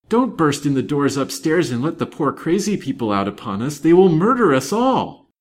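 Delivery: frantic warning building to a high cry; loud, high, terrified maid; panted plea. A narrator's reading. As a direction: plead in rising panic. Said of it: "she panted".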